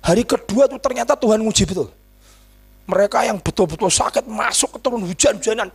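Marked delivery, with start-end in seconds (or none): none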